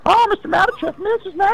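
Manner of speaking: high-pitched mock movie voice; mock film character. Mimicking how film characters might speak.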